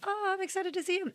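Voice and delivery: bright, hopeful lift; eager, excited. Retold or imitated. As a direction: sound genuinely thrilled to see him. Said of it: "and I'm like".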